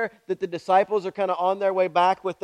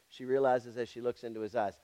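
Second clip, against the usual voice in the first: quiet; 7 semitones lower, 11 dB quieter than usual. Shown as a demonstration. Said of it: "repeated line for emphasis".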